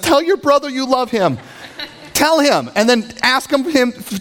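high-pitched imitation of mother's orders; pleading mom. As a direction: play a mom urging her sons to make up. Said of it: "puts us together and says".

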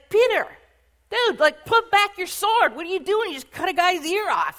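loud, high-pitched scolding of a friend; exasperated rebuke. Acted. Jesus rebuking Peter after the sword swing, exasperated. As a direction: blurt a hammed-up, incredulous telling-off. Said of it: "Jesus starts to rebuke Peter".

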